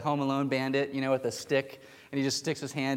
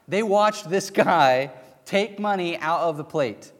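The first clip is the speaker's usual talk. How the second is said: ordinary talk, nothing marked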